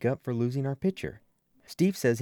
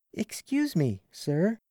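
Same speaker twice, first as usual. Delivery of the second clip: put-on slow, higher girl's voice, stubborn; Rita's voice; 8 semitones higher, much slower than usual. A narrator's reading. Rita protesting to her coach, slow and high. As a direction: drag it out like a reluctant teen pitcher.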